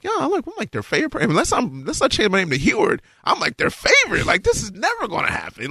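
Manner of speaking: high mocking swagger; cocky boast. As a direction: brag like the fans adore you. Cocky retort to God.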